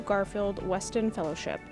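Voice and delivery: normal talking voice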